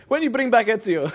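high, loud fake Italian accent; hammed-up impression of an eager Italian, pitched high; mock Italian accent. Friend imitates the Italian journalist's question, mock Italian accent. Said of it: "So the Italian's like".

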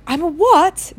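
high stunned question; gasping Harry. A narrator's reading. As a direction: gasp it out in shock. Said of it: "gasped Harry".

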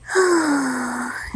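long, high exhaled sigh. Real, not acted. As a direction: let out a drawn-out sigh. Sighs before greeting viewers.

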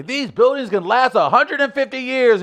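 grand, high proud boast; old architect voice. Retold or imitated. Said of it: "the architects back in the day would say".

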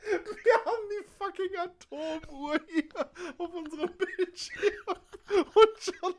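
laughing while talking, high-pitched. Real, not acted. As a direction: crack up mid-sentence. Laughing at clapping sync mishap.

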